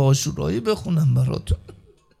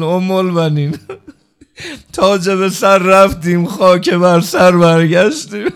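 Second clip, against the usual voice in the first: louder, slow, tearful mourning wail; weeping lament; 8 dB louder than usual. Retold or imitated. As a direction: cry it out as a grieving lament.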